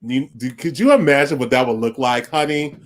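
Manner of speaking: usual speaking voice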